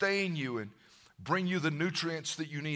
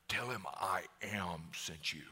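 slow, soft whisper; whispered God voice; 11 semitones lower, 12 dB quieter than usual. Acted. God answering Moses, slow whisper. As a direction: breathe it slowly like a holy secret.

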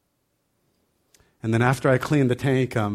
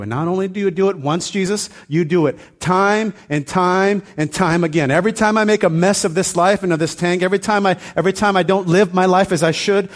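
fervent prayer; 6 semitones higher, 5 dB louder than usual. Acted. Grateful believer praising Jesus for restoring, loud and fervent. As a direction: lift it up, praising with full force.

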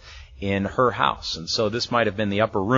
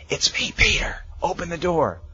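high urgent whisper at the gate; 6 semitones higher than usual. Retold or imitated. Peter whispering through the gate at night, urgent. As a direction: hush it, it's late and dangerous.

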